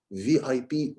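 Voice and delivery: lightly voiced from the mouth; weak mouth voice. Shown as a demonstration. Coach repeating the weak mouth take. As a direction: say it weakly, from the mouth only. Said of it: "it sounds weak".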